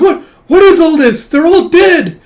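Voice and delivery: high, loud, panicked disbelief; horrified screaming. Acted. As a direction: scream as a child surrounded by corpses. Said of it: "And she screams, like".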